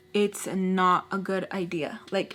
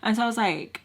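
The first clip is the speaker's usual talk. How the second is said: ordinary talk, nothing marked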